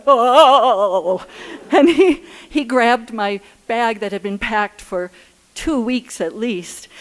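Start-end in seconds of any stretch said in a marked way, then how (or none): 0.0-1.2 loud surprised oh from the husband; louder mock gasp of surprise; startled exclamation. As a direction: blurt it out, woken and alarmed. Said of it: "And he went".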